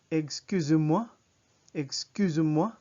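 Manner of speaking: loud "Excusez-moi" with a clear Z before "moi"; French pronunciation. Shown as a demonstration. Teacher models "Excusez-moi" for repeating, loud and clear with one Z. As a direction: project a French "Excusez-moi", lifting the end. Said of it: "Notice that it's z".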